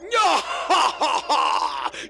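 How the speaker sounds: big evil cackle; villain laugh. Acted. Villain in played kids' show clip.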